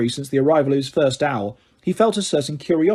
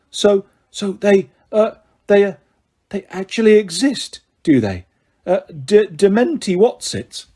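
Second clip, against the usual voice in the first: croaked; 4 semitones higher than usual. A narrator's reading. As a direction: stumble out the words, dumbfounded. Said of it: "opened it for a third time and croaked".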